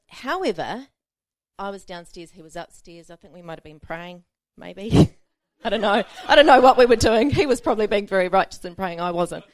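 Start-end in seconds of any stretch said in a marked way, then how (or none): 5.6-9.0 laughing. Real, not acted. Laughing at her own story.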